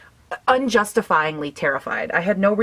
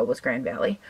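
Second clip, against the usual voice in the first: usual speaking voice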